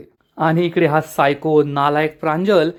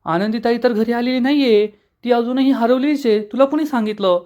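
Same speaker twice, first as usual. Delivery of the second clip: pitched higher in disbelief; shocked; 7 semitones higher than usual. Acted. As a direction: blurt it out, stunned. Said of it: "आरची आणि आरतीला आश्चर्याचा धक्काच बसतो". (धक्का बसलेला, उंच सुरातला आश्चर्याचा स्वर)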